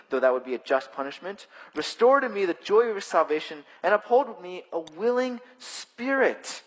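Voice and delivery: measured, fervent psalm reading; solemn scripture reading. Acted. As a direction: read it as a heartfelt prayer. Reads the psalm aloud.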